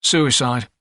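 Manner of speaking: matter-of-fact, unembellished answer; neutral. A narrator's reading. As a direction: state it without any show of feeling. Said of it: "the attendant said neutrally".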